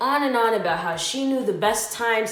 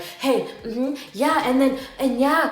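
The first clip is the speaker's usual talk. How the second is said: insistent mock chatter, a bit higher; imitated pestering; 4 semitones higher than usual. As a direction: mimic someone demanding your attention. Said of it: "she'll keep tapping you like".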